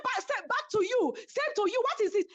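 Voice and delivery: high, sharp mock retort; retold snapping back. Caroline snapping back at the speaker, high mock retort.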